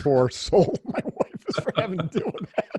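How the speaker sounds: laughing at himself through the words. Real, not acted. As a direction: chuckle while pitying his wife. Laughing about his wife.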